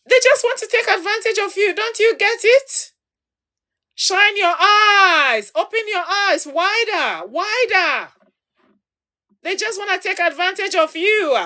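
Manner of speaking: high, insistent pleading; urgent appeal to open their eyes; urgent warning. Real, not acted. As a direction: shake them awake.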